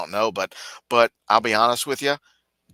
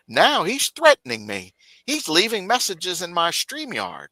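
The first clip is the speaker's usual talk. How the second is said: higher, slowed whiny complaint; mock complaining; 9 semitones higher, slower than usual. [name] complaining to his viewers, high whiny tone. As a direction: complain like the victim.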